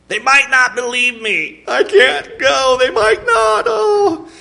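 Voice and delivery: a frightened man talking himself out of going; fretful Moses. Acted. Moses voiced in fearful panic.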